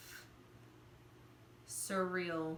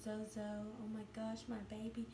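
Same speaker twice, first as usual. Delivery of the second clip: put-on soft, hushed awe over the baby; tender; 3 semitones higher, 9 dB quieter than usual. New mother meeting her baby, soft and amazed. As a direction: coo it to your newborn. First meeting newborn on chest.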